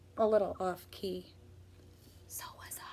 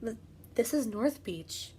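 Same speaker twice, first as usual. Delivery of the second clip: nervous stammer; 5 dB quieter than usual. Acted. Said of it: "I said".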